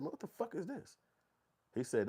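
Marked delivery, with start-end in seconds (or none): 0.0-1.0 much higher, soft incredulous voice; mock disgust. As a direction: recoil at the strange food. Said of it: "and I said".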